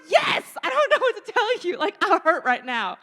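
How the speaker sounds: loud, high, laughing through it; exasperated laughing insistence. Retold or imitated. Patient insisting to the nurse, loud and laughing. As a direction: insist you hurt, cracking up. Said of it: "I'm like".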